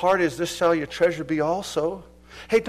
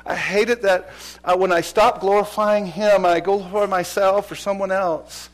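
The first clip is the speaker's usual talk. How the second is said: ordinary talk, nothing marked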